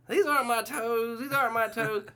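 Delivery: put-on high complaining old woman; toenail lady. Old resident wandering in, high confused complaint. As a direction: whine it like a confused grandma. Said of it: "here comes toenail lady".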